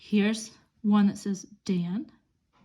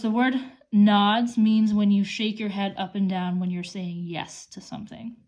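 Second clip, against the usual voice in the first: ordinary delivery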